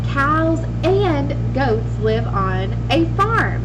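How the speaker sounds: slow, careful example sentence; slow model sentence. Shown as a demonstration. Teacher reading a slow example sentence to young pupils. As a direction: read it out slowly for the class. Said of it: "Ooh, did you hear my word and in the sentence".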